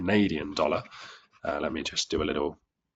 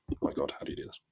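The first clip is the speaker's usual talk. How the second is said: much softer, half-whispered aside; muttered to herself; 8 dB quieter, much faster than usual. Real, not acted. Teacher fumbling the drawing tool, whispering a frustrated aside to herself. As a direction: mutter under your breath in frustration.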